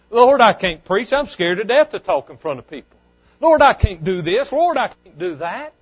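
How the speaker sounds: a scared preacher loudly pleading he can't preach; loud pleading prayer. Retold or imitated. Preacher reliving his fearful plea to God, loud pleading. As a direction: cry out to the Lord, loud and begging.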